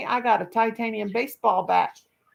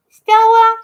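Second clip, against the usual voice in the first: loud high shout of the name; calling the dog; 13 semitones higher, 16 dB louder than usual. Real, not acted. Owner hollering for her dog, loud high call.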